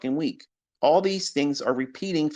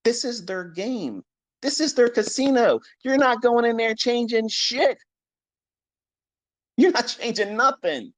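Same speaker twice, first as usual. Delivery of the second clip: heated rant; 10 semitones higher than usual. Real, not acted. Ranting at traders about the market.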